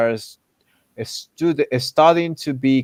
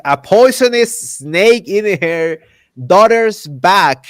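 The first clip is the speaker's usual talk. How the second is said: louder, higher, punching each word; incredulous emphasis; 6 semitones higher, 7 dB louder, slower than usual. Real, not acted. Arguing the mayor was right to hit her, incredulous. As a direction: stretch it out in loud disbelief. Outraged retelling of the mayor's case.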